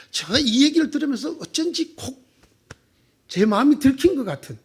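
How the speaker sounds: ordinary talk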